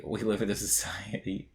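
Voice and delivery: a hint of a clown mascot, dropped low; Ronald McDonald voice. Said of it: "I'm gonna use the Ronald McDonald voice to say".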